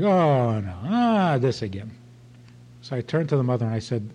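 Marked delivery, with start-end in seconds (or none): 0.0-1.9 imitating a fed-up daughter with drawn-out, swooping groans; mock eye-rolling exasperation. Teenage daughter reacting to her crying mother, mock exasperation. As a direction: groan it in mock weariness, dropping at the end. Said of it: "rolled her eyes".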